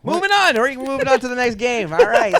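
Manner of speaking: high, loud and emphatic, cutting the topic off; loud topic change. Real, not acted. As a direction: push the talk on to the next game. Abruptly changing the awkward topic.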